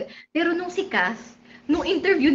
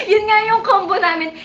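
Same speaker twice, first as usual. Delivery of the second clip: high laughing burst; 7 semitones higher, 6 dB louder than usual. Real, not acted. Vlogger cracking up about the team combo, laughing. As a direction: laugh through it.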